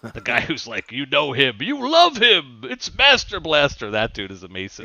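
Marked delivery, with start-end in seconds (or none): 0.9-3.9 hammed-up Thunderdome announcer. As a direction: introduce the fighter to the crowd.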